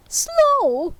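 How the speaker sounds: high, loud outraged echo; indignant ghost. Acted. Ghost echoes Scrooge in high, loud outrage. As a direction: throw the word back.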